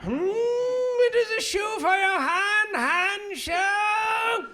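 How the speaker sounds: high, loud, slow mock German accent. First German to see a glove, loud mock German accent. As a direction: proclaim it like a proud German inventor. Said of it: "First German person to see".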